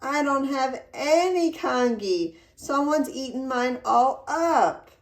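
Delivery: a hint of a little boy complaining; mild child's whine; lightly voiced wailing child. A narrator's reading. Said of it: "Little Chan wailed".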